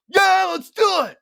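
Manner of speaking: high, excited impression shout; wildly keen movie star; Nicolas Cage. Shown as a demonstration. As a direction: explode into a hammed-up high yell. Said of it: "that's the Nicolas Cage".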